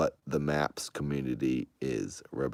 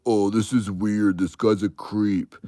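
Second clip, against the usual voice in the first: mocking viewer; 6 semitones higher, 6 dB louder than usual. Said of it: "they'll go".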